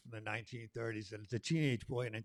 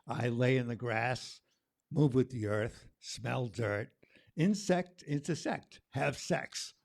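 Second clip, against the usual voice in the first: rhythmic, punchy spoken word read; spoken word poem; 2 semitones higher, 9 dB louder, slower than usual. Acted. Poet performing the summer poem for the crowd, rhythmic and bold. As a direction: ride the beat of summer.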